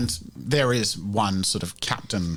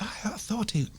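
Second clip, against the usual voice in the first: whisper; 12 semitones higher, 6 dB quieter than usual. Acted. Crowd members whispering rumours.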